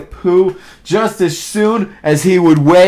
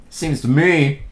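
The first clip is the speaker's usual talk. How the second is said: low, soft, ponderous voice; slow storybook donkey; slow gloomy donkey; 5 semitones lower, 7 dB quieter than usual. A narrator's reading. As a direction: mull it over slowly and quietly. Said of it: "said Eeyore slowly".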